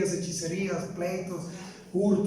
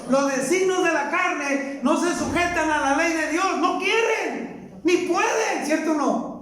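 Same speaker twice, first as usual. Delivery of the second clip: emphatic preaching; 8 semitones higher than usual. Acted. Preacher hammering the verse home, high emphatic preaching.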